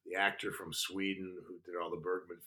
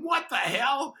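high, loud shout of disbelief; incredulous outburst; 8 dB louder, slower than usual. Retold or imitated. Actor seeing the same rivals back, incredulous shout. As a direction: burst out, voice leaping up.

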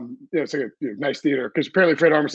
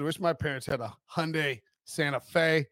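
ordinary talk

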